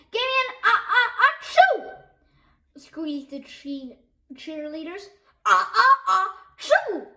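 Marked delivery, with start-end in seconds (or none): 0.1-1.9 high cheer collapsing into a big sneeze; chant into sneeze. A narrator's reading. 5.5-7.1 loud comic build-up sneeze; hammed-up ah-ah-achoo; crowd sneeze. A narrator's reading. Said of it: "repeated the fans".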